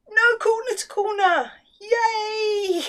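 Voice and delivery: high, bright shout of joy; excited cheer. Real, not acted. Cheering no corner to corner pattern.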